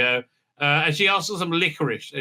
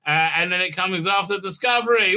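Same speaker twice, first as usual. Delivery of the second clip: ordinary talk, nothing marked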